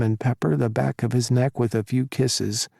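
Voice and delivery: usual speaking voice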